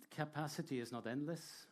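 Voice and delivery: ordinary delivery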